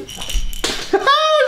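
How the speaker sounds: very high squealing laugh; high-pitched exasperated laugh. Real, not acted. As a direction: burst into a high, helpless cackle. Loses it over broken trick.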